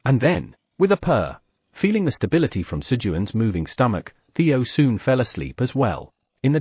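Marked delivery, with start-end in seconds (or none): none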